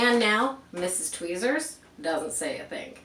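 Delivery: ordinary delivery, nothing marked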